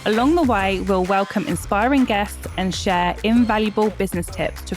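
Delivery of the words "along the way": ordinary talk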